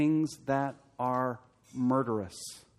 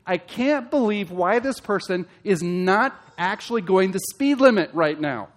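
impatient driver; 5 semitones higher, 6 dB louder than usual. Retold or imitated. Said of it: "Here's me".

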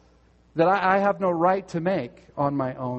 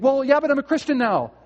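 defensive excuse; 8 semitones higher, 4 dB louder than usual. Retold or imitated. Imagined excuse voiced.

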